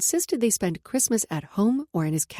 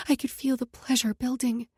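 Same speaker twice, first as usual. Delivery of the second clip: plain reading voice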